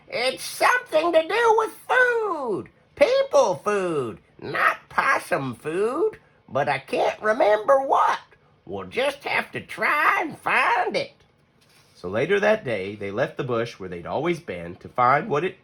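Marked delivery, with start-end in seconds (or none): 0.1-11.1 excited shout. Acted. Grandma Poss shouts her discovery to Hush, high excited old voice. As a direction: burst out with the idea, slowing on possum food. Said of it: "she shouted".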